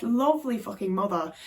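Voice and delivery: usual speaking voice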